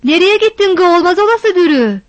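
loud call across the yard, high. A narrator's reading. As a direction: call the girl's name from afar. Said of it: "diye seslendi".

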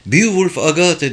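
annoyed, pitch jumping up; irritated repeat. A narrator's reading. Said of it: "diye tekrarladı Price sinirli bir sesle".

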